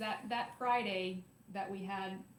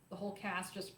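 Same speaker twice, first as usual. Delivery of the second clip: normal talking voice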